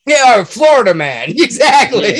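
loud laughing Florida man. Real, not acted. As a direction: laugh while agreeing.

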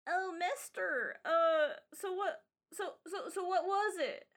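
put-on eager young kid. Child asking the mister what it was, lightly voiced kid. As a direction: ask as an uncertain, stumbling kid.

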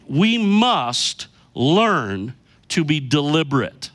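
slow, weighty stress on "must", "learn" and "deliberate"; emphatic. Real, not acted. Preacher announcing the key statement to the congregation, emphatic. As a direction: drive home "must", "learn" and "deliberate" one by one. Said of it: "I wanna say this emphatically".

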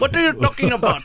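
high, puzzled mock question; confused cashier. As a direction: play the baffled clerk, voice lifting high. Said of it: "the guy looks at you like".

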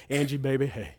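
low, hushed mock-seductive croon; real romantic. Preacher hamming up romance for his wife, real romantic. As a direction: play up the romance, very soft and low. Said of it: "I mean, like, real romantic".

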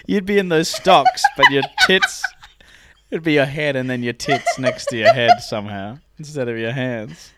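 real laughter breaking into the words; talking through laughter. Real, not acted. Friend painting an absurd stocks scene, talking through laughter. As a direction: keep riffing while cracking up.

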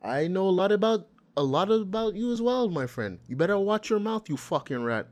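lightly imitated Irish-fighter taunt, high on the key words; hammed-up Connor threat, pitch jumping up then dropping; Connor mocking impression. Connor taunting Khabib's manager at the press conference, mock threatening. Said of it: "Connor goes".

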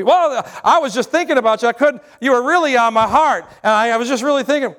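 overfriendly caller laying it on thick; gushing caller. Retold or imitated. Overly familiar caller acted.

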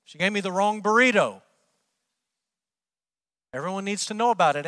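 mock angry complaint. Preacher mocking a congregant's burrito complaint, hammed-up angry rant. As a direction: play the petty customer fuming over a burrito. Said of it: "You say".

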